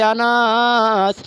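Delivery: slow melodic summons; calling out. Acted. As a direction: call out with a long held vowel. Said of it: "নবী ডাক দিয়া বলে".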